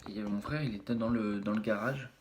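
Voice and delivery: ordinary talk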